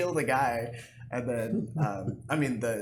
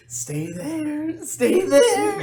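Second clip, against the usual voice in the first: much higher, louder yelled order; mock gunpoint yell; 11 dB louder, much slower than usual. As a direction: bark it like a gunman holding someone up. Gunmen yelling in retold clip.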